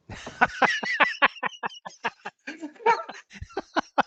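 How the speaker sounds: real laugh, pitched high. Real, not acted. Player cracks up mid British accent. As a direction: break into a high giggle.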